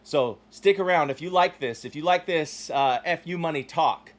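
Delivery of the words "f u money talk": normal talking voice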